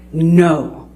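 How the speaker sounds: imitating a slow, firm refusal with long drawn-out words; measured, spaced-out retold command, as if calming a horse; slow and controlled. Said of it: "very slow but very concentrated, very controlled".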